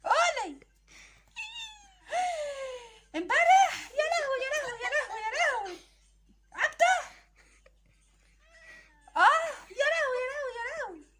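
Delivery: high, crying wail of "يا لهوي"; keen like a wronged wife; wailing lament. Acted. Wife wailing over her husband's new marriage, high and crying.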